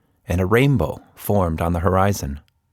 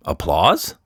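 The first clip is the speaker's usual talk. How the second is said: ordinary delivery, nothing marked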